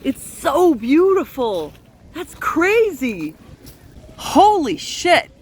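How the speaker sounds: slow, loud gasps of wonder at the park; awestruck. Real, not acted. Awed at the royal park.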